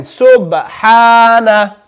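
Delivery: slow subhana with echoed b; qalqala on b. Shown as a demonstration. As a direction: bounce the b, stretch the ha of subhana. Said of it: "echoing out the sound".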